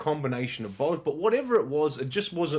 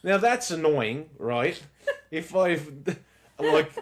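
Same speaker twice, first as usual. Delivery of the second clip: ordinary talk, nothing marked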